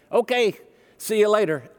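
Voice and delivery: mock cheerful hospital goodbye; breezy mock nurse. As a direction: toss it off like it's nothing. Nurses hand over newborn.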